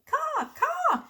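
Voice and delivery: very high cawing like a crow; a child copying the crows near her preschool. Retold or imitated. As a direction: caw caw like a crow calling. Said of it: "I like to hear them say".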